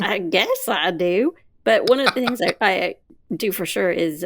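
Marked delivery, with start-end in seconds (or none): 0.0-1.3 slow, stretched Southern echo. Retold or imitated. Co-host playing along with the tease, drawled Southern. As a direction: lean into the Southern vowels, slowly. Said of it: "really went Southern on that".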